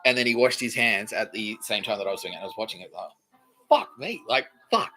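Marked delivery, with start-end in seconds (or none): 3.7-4.9 sudden high fuck me; stunned curse. Retold or imitated. Man at the urinal, stunned, high-pitched blurt. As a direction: blurt it out like you just saw something shocking.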